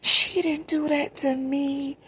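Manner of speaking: high, slow, pleading denial; wailed, drawn-out protest; retold disbelief. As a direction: draw it out high, refusing to believe it. Acts out her own disbelief.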